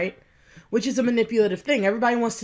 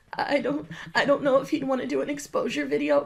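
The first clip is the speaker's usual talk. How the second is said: mock innocent, higher pitch; 5 semitones higher than usual. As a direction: act all innocent, a bit higher. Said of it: "She's acting like she's super innocent".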